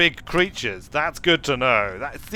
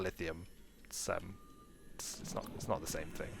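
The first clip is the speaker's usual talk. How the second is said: 1 deflated mumble; 10 semitones lower, 21 dB quieter than usual. Real, not acted. Disappointed ore not lithium.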